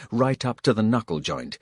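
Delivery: ordinary delivery, nothing marked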